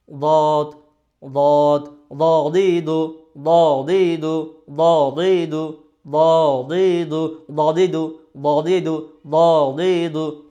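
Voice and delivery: slow, loud "দদিদু", heavy and drawn out; drilled "দদিদু". Shown as a demonstration. Teacher drills students on the heavy letter, slow and loud. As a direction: stretch each syllable of "দদিদু" with a thick "দ". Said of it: "এটা আমরা practice করব বারবার".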